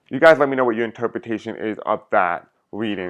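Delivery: usual speaking voice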